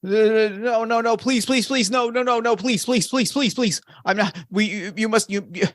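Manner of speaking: high, frantic, stammered pleading; distressed begging. Acted. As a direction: plead in panic, tripping over repeated words. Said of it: "in a state of distress. They are begging".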